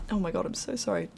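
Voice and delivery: usual speaking voice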